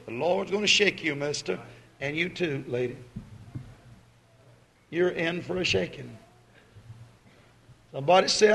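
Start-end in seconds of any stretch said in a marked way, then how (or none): 0.1-6.2 quiet warning. Real, not acted.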